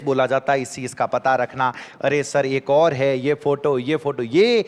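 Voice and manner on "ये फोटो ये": normal talking voice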